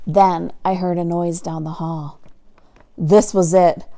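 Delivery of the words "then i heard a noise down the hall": ordinary delivery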